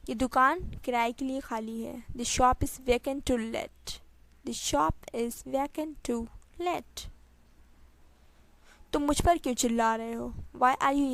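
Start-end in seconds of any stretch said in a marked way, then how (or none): 4.5-7.1 slow, separated words; slow repeat. Shown as a demonstration. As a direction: spell it out word by word. Teacher repeats sentence slowly. (धीरे-धीरे, शब्द अलग करके)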